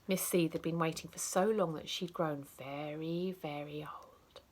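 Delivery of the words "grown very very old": breathy hushed whisper; spooky whisper on old. A narrator's reading. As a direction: let it fade to a whisper. Old age stressed.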